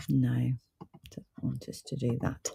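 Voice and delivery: soft mutter under the breath; muttering to self. Real, not acted. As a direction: mumble it quietly to yourself, half-aside. Reading the screen, then repeating aloud.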